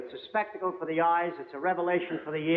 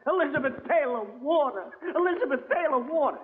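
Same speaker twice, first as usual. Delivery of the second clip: building mock yell, rising higher each time; yelling in the theatre; 8 semitones higher than usual. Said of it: "started yelling".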